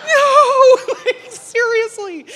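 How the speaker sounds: a very high, loud wail breaking into a laughing sob; mock-horrified shriek at the neighbour's crash news; horrified disbelief. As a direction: squeal it out half-laughing, half-crying. Hearing the plane crashed last week.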